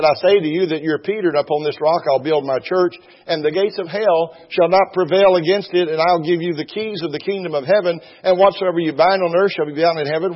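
high, loud fervent preaching; preaching at full force. Real, not acted. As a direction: lift the congregation, ringing out. Quoting Jesus fervently.

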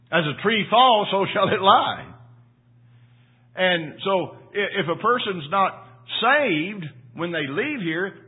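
preaching at full force. Acted. Pastor warning the congregation about eternity, preaching loud. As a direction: preach it out like thunder from the pulpit.

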